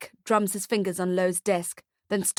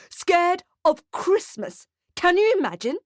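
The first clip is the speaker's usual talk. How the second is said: incredulous outburst; 9 semitones higher, 4 dB louder than usual. Acted. Nick mocks the lawyer's claim.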